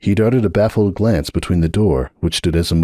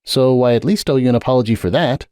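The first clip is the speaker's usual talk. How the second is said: lightly voiced apologetic stallion, a bit higher; sorry and earnest, slightly raised pitch; Sunburst's voice; 6 semitones higher than usual. A narrator's reading. Sunburst apologizing to Hoops at the door, lightly voiced.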